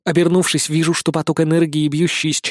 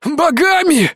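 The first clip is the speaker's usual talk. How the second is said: much higher, louder desperate cry; frantic dying wheeze; 11 semitones higher, 3 dB louder, slower than usual. A narrator's reading. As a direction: push the repeat up into panic. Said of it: "хрипит он".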